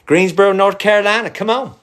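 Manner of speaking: loud, high call-out to the city; excited shout-out. Real, not acted. Streamer calling out to Greensboro fans, excited shout.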